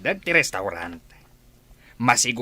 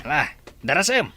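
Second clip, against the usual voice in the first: fast, urgent, rising to a louder push; hurried urging; 6 semitones higher, 4 dB louder, faster than usual. Acted. Boss urging his partner to hurry before the man leaves, fast and urgent. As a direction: hurry him along before the man drives off.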